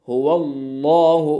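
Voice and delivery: slow हुवल्लाहु with a heavy, full lam; slow Quranic हुवल्लाहु; full-mouthed Allah. Shown as a demonstration. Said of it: "मुंह भर के पढ़ेंगे".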